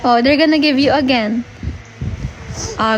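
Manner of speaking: ordinary delivery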